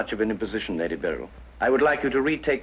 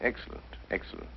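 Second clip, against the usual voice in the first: whispered aside; 11 dB quieter than usual. Acted. Holmes pleased, to himself.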